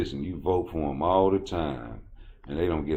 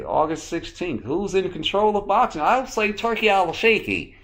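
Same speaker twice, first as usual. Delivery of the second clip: high, louder put-on voice; 12 semitones higher, 5 dB louder than usual. A comic imitating a pundit in a high put-on voice. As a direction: ham up a proper boxing pundit. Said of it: "talk like that sometimes".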